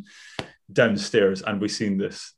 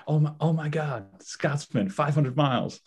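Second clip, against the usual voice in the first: lightly voiced excited American tourist, higher; 6 semitones higher, slower than usual. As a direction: gush like a thrilled American fan. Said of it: "the Americans loved it".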